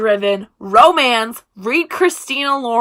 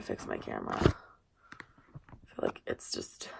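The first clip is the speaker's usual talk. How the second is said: muttered aside; 16 dB quieter, slower than usual. Real, not acted. Muttering while fixing camera.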